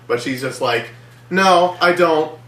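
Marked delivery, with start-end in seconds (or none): 1.3-2.4 mocking dumb line. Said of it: "one of the dumbest lines ever".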